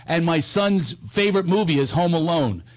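ordinary talk

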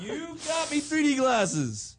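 high, slow, gushing thrill; delighted. Real, not acted. Delighted at the gift.

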